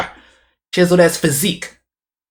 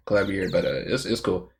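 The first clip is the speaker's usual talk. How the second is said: usual speaking voice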